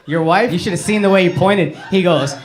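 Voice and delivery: ordinary talk, nothing marked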